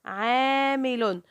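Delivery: "عامل" with the alif held very long; slow, sustained long a in "عامل"; long vowel. Shown as a demonstration. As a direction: stretch the first syllable of "عامل". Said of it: "المد مع الممدود".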